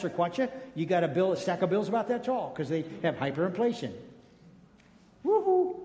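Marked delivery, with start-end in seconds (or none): 5.2-5.8 high woohoo at normal volume; playful whoop. Real, not acted. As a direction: let out a sudden high little whoop. Spontaneous whoop in mid-sermon.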